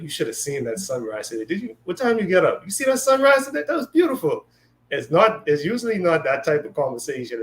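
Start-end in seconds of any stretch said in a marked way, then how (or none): 0.0-4.4 high, rising gush about a sunrise; mock-excited, much higher pitched. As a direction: rave about the sunrise in a lifted voice.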